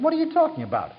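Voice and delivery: high-pitched incredulous question; baffled retort. Retold or imitated. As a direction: mimic a taken-aback debate opponent. Retells relativist's baffled retort.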